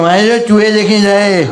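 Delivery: furious rickshaw driver shouting; angry outburst. Retold or imitated. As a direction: blow up at the boy. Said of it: "अबा कदर भड़क गया".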